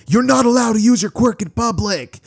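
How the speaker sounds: high, loud scolding yell; angry yell. Acted. Angry bystander yelling at the boy, high and loud. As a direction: shout him down from the crowd.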